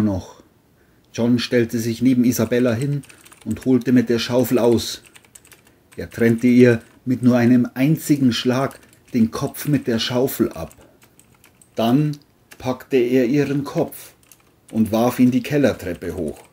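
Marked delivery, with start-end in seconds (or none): none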